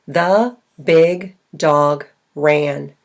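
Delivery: slow, a clear gap after each word; spaced-out demonstration sentence. Shown as a demonstration. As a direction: separate every word for counting. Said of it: "there are four words".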